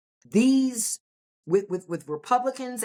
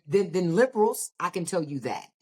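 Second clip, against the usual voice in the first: ordinary talk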